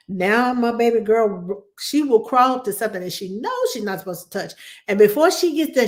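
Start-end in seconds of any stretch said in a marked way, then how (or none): none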